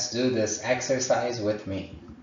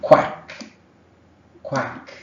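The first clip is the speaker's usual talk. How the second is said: duck quack imitation; 5 semitones higher, 6 dB louder than usual. Shown as a demonstration. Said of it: "Which animal says quack?".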